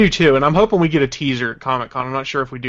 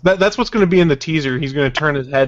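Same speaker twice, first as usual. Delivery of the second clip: ordinary talk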